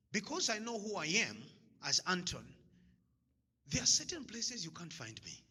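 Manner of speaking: ordinary delivery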